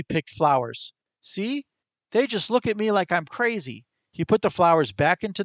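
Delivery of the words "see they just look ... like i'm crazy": high, quick squirrel voice pitched well up; chirpy little animal voice for the squirrel; talking squirrel. A narrator's reading. Narrator voicing the squirrel to Chester, high chirpy squirrel.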